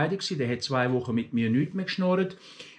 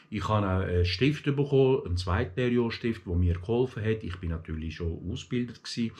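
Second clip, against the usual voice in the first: ordinary talk, nothing marked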